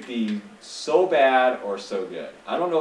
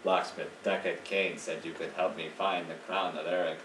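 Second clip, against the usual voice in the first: low, softer hero reading a line; game character voice; 5 semitones lower, 7 dB quieter than usual. Acted. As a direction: play the questing hero, low and restrained. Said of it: "I did use different voices".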